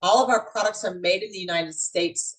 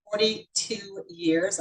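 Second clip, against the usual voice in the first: forty-two years drawn out very slowly; super slow; 3 dB quieter, much slower than usual. Real, not acted. Consultant telling guests her long tenure, super slow. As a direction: stretch out each word for emphasis. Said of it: "I say that super slow".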